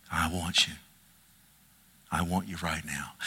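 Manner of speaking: low, hushed voice sinking towards a whisper; tender calling. Acted. God answering someone who says they're a mess, tender and hushed. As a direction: draw them in softly and very low.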